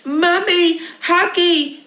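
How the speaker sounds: put-on high loud toddler wail; screaming baby. Baby screams for a hug from mummy. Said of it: "screamed the baby".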